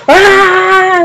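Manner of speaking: loud, high mock scream; panicked scream. Retelling Freddy fleeing from Sam, mock panicked scream. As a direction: yelp out a drawn-out cartoon "ah". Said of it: "he's like".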